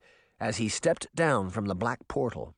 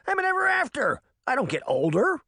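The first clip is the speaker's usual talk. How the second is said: voice cracking; 13 semitones higher, faster than usual. A narrator's reading. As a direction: voice cracks high on words. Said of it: "his voice cracked on several of the words".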